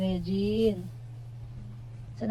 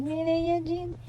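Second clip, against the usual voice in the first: high sing-song calling the name; 7 semitones higher, slower than usual. Real, not acted.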